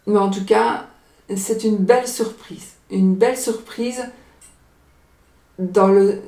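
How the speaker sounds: usual speaking voice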